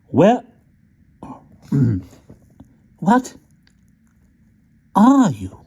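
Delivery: high, squeaky exasperated demand; a tiny soldier losing patience; exasperated toy soldier. A narrator's reading. Small wooden soldier to the rat, high and exasperated. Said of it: "he said at last in some exasperation".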